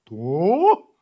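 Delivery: loud surprised dog; a startled loud what. Acted. Dog surprised by the kitten's answer, loud and startled. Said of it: "удивилась собака".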